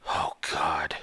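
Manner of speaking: breathy whispered dread under the breath. Acted. Liam whispering his dread at the counselor's arrival. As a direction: sink into a hushed oh God.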